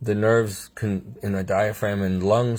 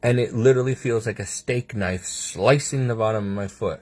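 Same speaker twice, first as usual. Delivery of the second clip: ordinary talk, nothing marked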